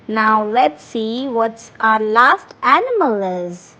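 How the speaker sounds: normal talking voice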